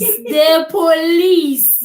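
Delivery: drawn-out, exclaimed disbelief; scoffing, long-drawn echo; incredulous. Real, not acted. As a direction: stretch it right out in amused disbelief. Mocking disbelief at being told police.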